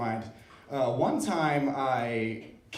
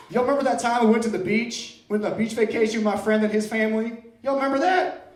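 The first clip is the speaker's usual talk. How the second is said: much higher and louder, building; loud mock nostalgia; 8 semitones higher, 6 dB louder than usual. Comic yelling old memories at the crowd, louder and higher. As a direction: shout the memory to the whole room.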